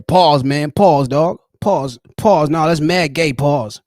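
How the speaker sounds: mock New York heckling; New York pause callout. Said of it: "That pause thing's big up there".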